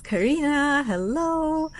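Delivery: light up for an arriving viewer; excited hello. Real, not acted. Welcoming Karina to the livestream, excited.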